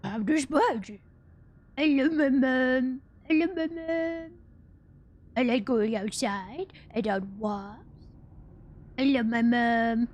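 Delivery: slow, drawn-out doting dog voice; Spudgy. Retold or imitated. Imitating the YouTubers' dog, slow silly pet voice. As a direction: stretch the words like a goofy talking dog. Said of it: "Spudgy was their dog, so it'd be like".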